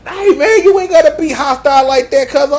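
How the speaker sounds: lightly voiced hype friend. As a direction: greet him like an eager homie.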